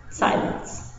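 ordinary delivery

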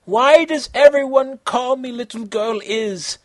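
put-on Konata, high and girlish. Konata asking, in a high girl voice. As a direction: lift into a young girl's voice.

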